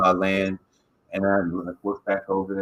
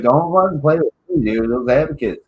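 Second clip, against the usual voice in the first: louder, higher mock-angry warning; retold warning; 6 semitones higher, 7 dB louder than usual. Telling how they warned a dude off, heated retold warning. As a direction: throw it out as a retold threat.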